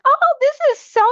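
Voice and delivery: high, loud gushing delight; mock delight at picking up a label. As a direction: gush like you found a treasure. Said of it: "you were like".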